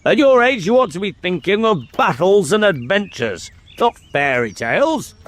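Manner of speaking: high-pitched scolding from a king; stern. Acted. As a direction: sternly put a child in his place. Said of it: "said the king sternly".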